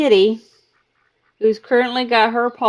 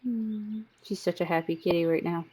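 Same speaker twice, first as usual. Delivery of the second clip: ordinary talk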